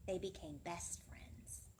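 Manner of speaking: hushed, tender whisper; soft whisper. Acted. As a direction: whisper it like a bedtime secret. Story's tender ending.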